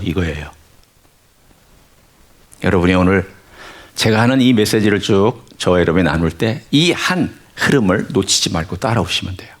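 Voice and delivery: normal talking voice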